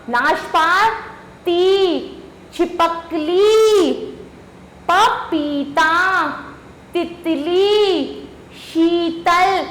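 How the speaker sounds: each word drawn out slowly; slow model reading. Shown as a demonstration. Teacher modelling words with long ई slowly. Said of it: "बड़ी ई की मात्रा लगाकर".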